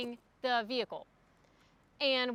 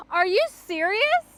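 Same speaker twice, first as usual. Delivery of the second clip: louder, higher, slowed-down disbelief; indignant retort; 6 semitones higher, 7 dB louder, slower than usual. Retold or imitated. To the sassy dealer, indignant and louder. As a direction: throw the question back in disbelief. Retelling her reply to rude dealer.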